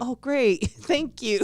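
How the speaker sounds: high-pitched, laughing mock gratitude; retold laughing relief. Retelling the score going back up, laughing relief. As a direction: act out relieved thanks with a laugh.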